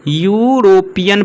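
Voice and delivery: slow "यूरोपियन", syllable by syllable; teacher slowly modelling the "yoo" opening of "यूरोपियन"; pronunciation demonstration. Shown as a demonstration. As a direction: stretch "यूरोपियन" into yoo-ro-pian. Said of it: "अब इसका उच्चारण देखिए यू है".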